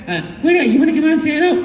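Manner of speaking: high, coaxing Mario. Retold or imitated. Mario coaxing Luigi to greet the child, high. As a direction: coax your shy brother out. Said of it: "And then I'd go".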